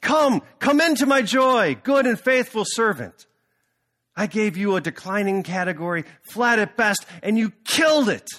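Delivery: loud, high, beaming proclamation; joyful shout. Acted. The CEO praising his star manager with a joyful, high shout. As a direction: call it out in pure delight. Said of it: "And he's yelling".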